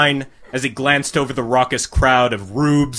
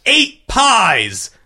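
higher, announced cry of "Eight pies"; judges crying out; 7 semitones higher than usual. A narrator's reading. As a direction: call the count out to the crowd. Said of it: "the judges cried in unison".

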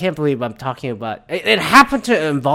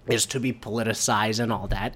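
usual speaking voice

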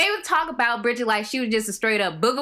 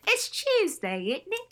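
her own proud British accent on It's Tuesday, isn't it, higher; 8 semitones higher, 3 dB quieter than usual. Shown as a demonstration. Host showing off her learned line, British accent. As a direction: show off the English accent, tag question rising. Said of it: "proud of myself for being able to do".

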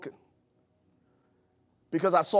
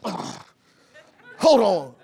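mock annoyed wife; 2 semitones higher, 7 dB louder, much slower than usual. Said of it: "She's like".

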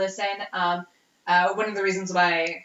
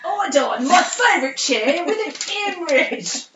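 high hammed-up British ghost; mock ghost voice; 9 semitones higher, 4 dB louder than usual. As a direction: play a chirpy dead tenant, overdone. Mocking Bill's quote.

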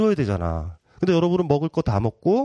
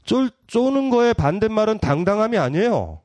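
normal talking voice

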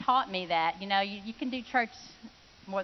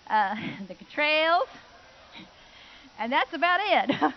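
laughing; 6 semitones higher, 7 dB louder than usual. Real, not acted. Laughing while naming few members.

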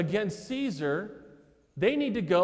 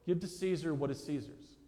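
plain, understated and softer; matter-of-fact; 3 semitones lower, 6 dB quieter than usual. Acted. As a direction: state it flatly, without any push. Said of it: "he says very flatly, very plainly, very matter-of-factly".

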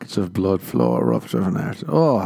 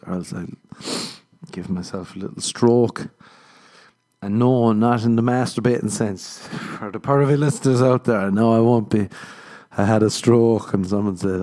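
usual speaking voice

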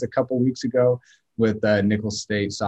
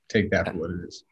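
ordinary talk, nothing marked

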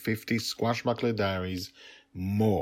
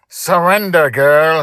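much higher, much louder screamed order; 9 semitones higher, 14 dB louder, slower than usual. Acted. Pirate captain screams at the five-year-old, loud. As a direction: scream at the top of your lungs. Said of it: "he screamed at the top of his voice".

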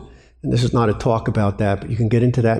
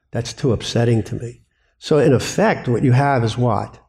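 usual speaking voice

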